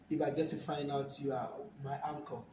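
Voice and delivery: ordinary delivery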